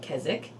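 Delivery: Keswick with a silent W; builders' pronunciation. Shown as a demonstration. Host quoting the builders' way, Keswick without the W. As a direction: say Keswick as "Kezzick". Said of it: "I think the builders call it".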